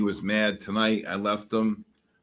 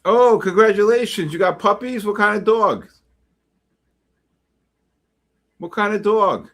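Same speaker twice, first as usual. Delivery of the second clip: loud, higher excited greeting; 9 semitones higher, 7 dB louder than usual. Real, not acted. Greeting a caller with new puppies, loud delight. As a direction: gush over the puppies.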